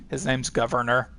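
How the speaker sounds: ordinary talk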